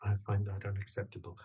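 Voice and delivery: low, very soft, quick delivery; quiet objection. Acted. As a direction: object under the breath. Same line, first taken low.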